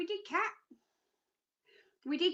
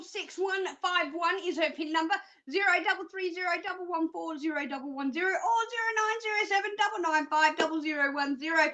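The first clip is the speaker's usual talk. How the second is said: rapid-fire phone numbers; talking too fast; 3 semitones higher than usual. Real, not acted. Psychic giving callers the phone numbers, talking fast. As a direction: rattle the digits off quickly. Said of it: "If I am talking too fast for you".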